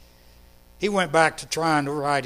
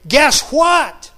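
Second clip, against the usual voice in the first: high, loud, bursting with joy; a child rushing in with big news; excited little girl; 8 semitones higher, 9 dB louder than usual. Retold or imitated. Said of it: "with a great big smile on her face".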